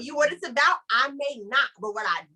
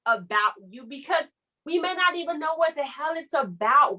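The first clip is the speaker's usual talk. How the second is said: ordinary delivery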